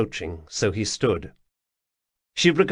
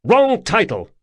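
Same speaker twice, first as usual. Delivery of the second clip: snapped; 11 semitones higher, 6 dB louder than usual. Acted. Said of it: "Atribus snapped".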